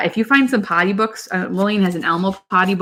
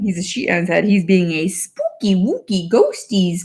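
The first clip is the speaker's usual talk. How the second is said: put-on slow, higher storybook British accent; 4 semitones higher, much slower than usual. Mom reads a potty book to her daughter, British accent. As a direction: read it like a cheery British picture book.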